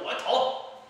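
a burst of quoted eagerness; eager young voter. Retold or imitated.

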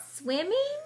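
high, rising hopeful squeak. Acted. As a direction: squeak it with hope. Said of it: "she squeaked hopefully".

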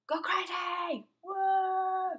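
high loud yell; wild excited shout. Real, not acted. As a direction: let it rip. Shouting in chaos.

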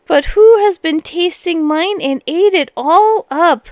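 high, upset little bear; teeny tiny bear voice. A narrator's reading. Tiny bear in tears over the soup, teeny tiny voice. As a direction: pipe up like a poor tiny bear. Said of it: "in a teeny tiny voice".

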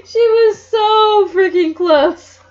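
high, loud, slow shout; exasperated shout. Real, not acted. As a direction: yell it out in frustration. Reader despairs at plot twist.

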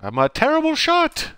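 high, loud yelp at his own bad aim; frustrated yell. Real, not acted. Gamer yelling at his own missed shots. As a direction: shout it out, laughing at yourself. Self-mocking outburst while gaming.

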